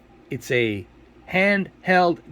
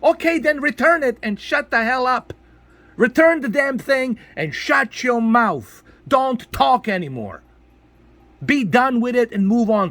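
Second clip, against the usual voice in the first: high, emphatic scolding; angry telling-off; 6 semitones higher than usual. Real, not acted. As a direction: order them to shut up, stretching each command. Telling complainers to shut up.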